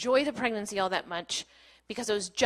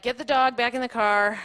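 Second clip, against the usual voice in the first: ordinary talk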